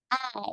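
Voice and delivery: "爱" started high and falling; careful fourth-tone "爱" for learners; demonstrated Mandarin tone. Shown as a demonstration. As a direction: drop down from a high "爱". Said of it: "learn a sentence".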